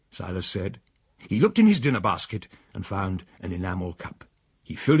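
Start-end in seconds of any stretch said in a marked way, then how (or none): none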